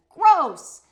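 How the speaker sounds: high, loud disgusted groan; exaggerated yuck; loud gross. Polly echoes gross, high and very loud.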